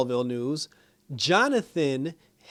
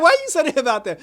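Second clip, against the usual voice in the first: high, loud quote broken by laughter; asking through the laughter; laughing disbelief; 12 semitones higher, 6 dB louder, faster than usual. Retold or imitated. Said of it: "I was like".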